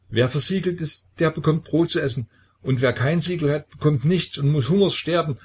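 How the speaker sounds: high, tearful stammering voice; childlike sobbing. A narrator's reading. Deserter Petka telling the brothers of the seals, high and tearful. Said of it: "wie ein Kind schluchzend zu sprechen".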